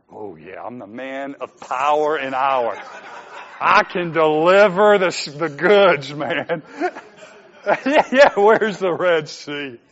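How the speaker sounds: hammed-up proud boast through laughter; mock bragging. Preacher mocking his own pride to the congregation. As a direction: puff yourself up as a joke, laughing.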